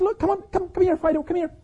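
put-on high-pitched boy calling a pet; boy calling his pigeon. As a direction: call the bird back in a high voice. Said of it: "he shouts out the pigeon's name".

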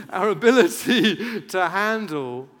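slow, loud words with real laughter; laughing. Real, not acted. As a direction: crack up while restarting the line. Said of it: "I'm not gonna be able to get that".

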